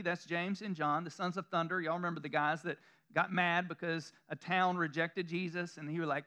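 ordinary delivery

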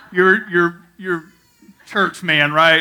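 loud, eager imitation of the neighbor; loud retold question. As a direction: call it out like a friendly neighbor.

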